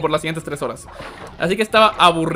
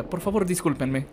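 ordinary talk

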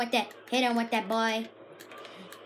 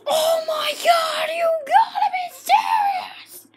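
high, loud, stretched-out cry of disbelief; disbelieving shout; 7 semitones higher, 7 dB louder, slower than usual. Real, not acted. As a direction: yell it out, half laughing, can't believe it. Teammates wiped out, disbelief.

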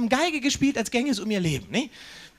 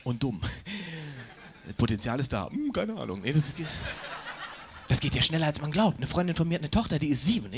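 usual speaking voice

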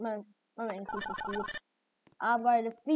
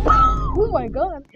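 loud, drawn-out disbelief; stunned gamer yell; shocked oh my god; 11 semitones higher, 12 dB louder, much slower than usual. Real, not acted. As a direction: let the shock stretch the words out. Reacting to kid jumping.

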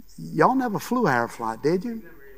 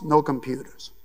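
ordinary talk, nothing marked

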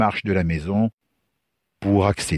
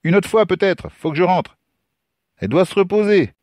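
high-pitched, hurried brush-off; tossed over shoulder; 12 semitones higher than usual. Acted. Lucas brushes off drunk stranger at the gate, hurried. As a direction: call it back while getting away. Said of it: "lança encore Lucas par-dessus son épaule".

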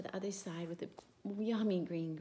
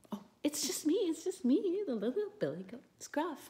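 high squeaky little goat voice, much higher and louder; 9 semitones higher, 4 dB louder than usual. Acted. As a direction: pipe up like a tiny nervous goat. Little goat answers troll.

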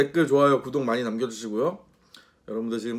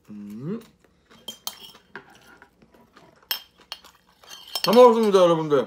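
eating with eggshell bits; chewing food; 4 semitones higher, 3 dB louder than usual. Real, not acted. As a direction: mouth full while talking. Seen 1: Picks shell from bowl, then stuffs food in mouth near end.

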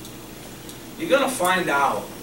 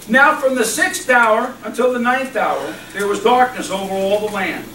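scripture reading; 6 semitones higher, 4 dB louder than usual. Acted. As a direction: proclaim the verse to the church. Reads scripture aloud.

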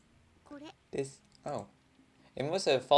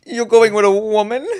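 laughing; 9 semitones higher, 12 dB louder than usual. Acted. Voicing the character, laughing.

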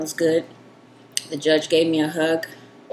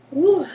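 high woo from the heat; 11 semitones higher, 4 dB louder than usual. Real, not acted. Reaction to spicy heat.

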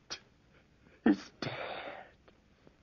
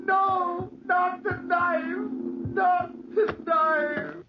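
put-on an old man shrieking at the knife; terrified screaming; slower than usual. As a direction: beg for life in terror.

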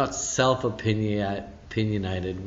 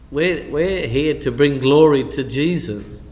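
preaching proclamation; 4 semitones higher, 7 dB louder than usual. Acted.

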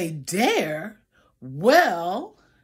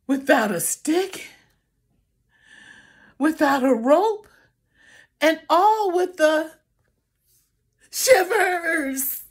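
higher, louder trembling climax; dramatic shivers; 4 semitones higher, 5 dB louder than usual. Acted. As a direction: quaver it up big. Climax of the bear story.

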